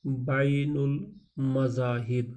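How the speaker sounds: بین المذاہب said very slowly, syllable by syllable; teacher sounding out بین المذاہب at half speed; slow spelling-out. Shown as a demonstration. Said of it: "teacher drills new word".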